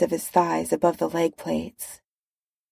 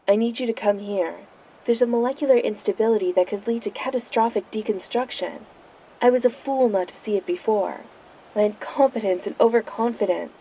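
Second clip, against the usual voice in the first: phone voice; 3 semitones higher than usual. Acted. Reed over the phone.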